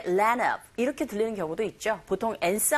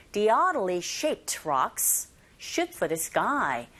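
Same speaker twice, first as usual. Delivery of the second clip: "oddly" with an open ah, every word drawn out; very slow model line; much slower than usual. Shown as a demonstration. Teacher repeats the sentence slowly as a model. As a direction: spell it out slowly for learners. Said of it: "slow repeat for drill".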